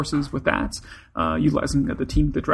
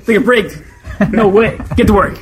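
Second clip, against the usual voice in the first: teasing call; 6 semitones higher, 9 dB louder than usual. Acted. Teasing coworker, calling out.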